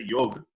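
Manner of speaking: yoga cut to yog, ending on g; Hindi-style dropped vowel. Retold or imitated. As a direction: say "yoga" as one syllable, yohg. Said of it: "those who have Hindi as the mother tongue". ("yoga" without its final a, as Hindi speakers say it)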